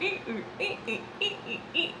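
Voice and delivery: high, soft mock squeal; imitating her squeal. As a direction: squeak like a nervous rider. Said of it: "I can just picture her".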